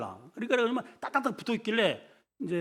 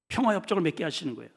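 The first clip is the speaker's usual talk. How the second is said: usual speaking voice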